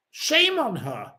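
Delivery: ordinary talk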